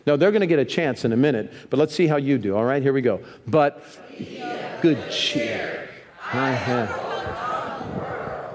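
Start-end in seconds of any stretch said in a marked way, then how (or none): 3.5-8.5 slow, drawn-out shouted verse. Real, not acted. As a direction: belt the verse out slowly together. Group reads verse in competition.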